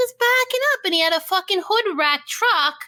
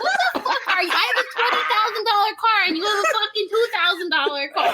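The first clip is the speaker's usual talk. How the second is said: higher-pitched, hurried rant with laughter; mock outraged driver; 3 semitones higher, faster than usual. Storyteller quotes the angry man comparing cars, mock outrage. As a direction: imitate his indignant tirade, quick and pitched up. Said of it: "he's like".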